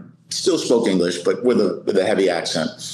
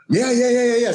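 high, rapid-fire yeses; retold panicky eagerness to comply; flustered agreement; 13 semitones higher than usual. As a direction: blurt it out high, all in a run. Flustered rookie answers his impatient trainer.

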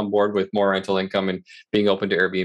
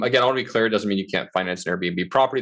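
usual speaking voice

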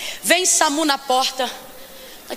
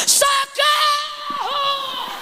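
high, desperate cries for help; panicked screaming; 10 semitones higher, much slower than usual. Pretend. Mother screaming over her dead son in panic. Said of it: "sabe o que ela faz?".

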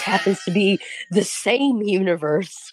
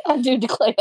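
Southern Belle; 4 semitones higher than usual. Pretend. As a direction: toss off a coy Southern Belle line. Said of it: "Southern Belle inflection".

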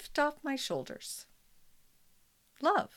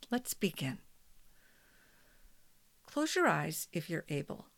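guided meditation; 5 dB quieter than usual. Acted. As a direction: guide them gently into calm.

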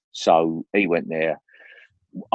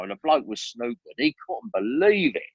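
normal talking voice

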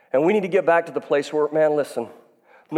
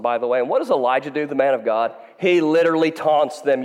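usual speaking voice

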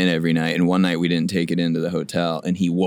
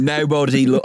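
higher mock New Zealand accent; put-on New Zealand accent; 6 semitones higher than usual. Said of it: "we can do accents for the".

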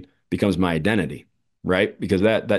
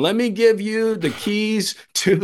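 much higher, slow, put-on coach voice; hammed-up higher, slow coach voice; mock coach, slow; 13 semitones higher, slower than usual. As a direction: drag it out like a smug coach handing over the keys. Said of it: "I used to joke where it's just like".

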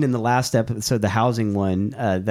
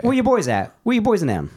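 higher-pitched New Orleans Yat accent; 9 semitones higher than usual. Shown as a demonstration. Host showing co-host the dialect, Yat accent. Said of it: "they call it the Yat".

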